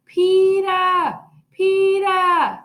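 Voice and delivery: high, loud call from afar. A narrator's reading. Fudge calling his brother from the jungle gym, loud call. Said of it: "until he called".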